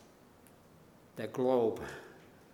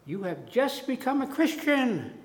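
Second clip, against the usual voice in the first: yelling; 11 semitones higher than usual. Retold or imitated. Chuck yelling across the building at [name]. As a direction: call out his name in joyful surprise. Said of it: "he yells to me".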